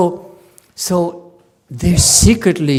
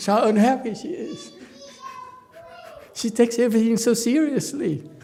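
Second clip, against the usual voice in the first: higher, laughing through a teasing quote; husband amused at his wife's gloom, laughing higher; laughing mock pity; 11 semitones higher than usual. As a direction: tease the serious wife with a chuckle. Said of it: "They say".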